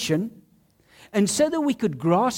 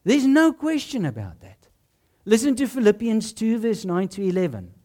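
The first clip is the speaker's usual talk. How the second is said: ordinary talk, nothing marked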